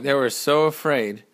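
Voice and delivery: ordinary delivery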